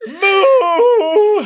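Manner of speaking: a big, high, bellowing moo; loud cow moo. Acted. Baby Cow bellows at the panicking crew. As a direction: moo like a charging calf, full voice.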